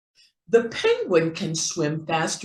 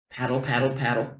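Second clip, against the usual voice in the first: lower, steady paddle, paddle rhythm; paddling duck; 6 semitones lower, much faster than usual. Acted. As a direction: paddle along like a calm mother duck. Duck paddling with her babies.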